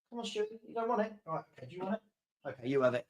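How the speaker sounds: hushed, quick coaxing, away from the mic; soft aside to the puppy. Real, not acted. Streamer softly coaxing his puppy to take the toy. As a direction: gently offer the dog his toy.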